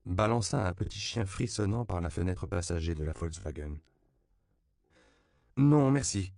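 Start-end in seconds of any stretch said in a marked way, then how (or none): 5.6-6.3 "Non" rings out high and loud, then a falling "merci"; a loud, high, courteous no; polite refusal. A narrator's reading. Missionary refusing the puppy, loud polite refusal. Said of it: "déclina poliment Edward Bancho".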